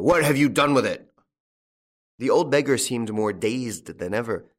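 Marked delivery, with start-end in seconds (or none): 0.0-1.1 loud, high demand; enraged farmer's shout; angry yell. A narrator's reading. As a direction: yell the accusing question. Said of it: "yelled old Goussot".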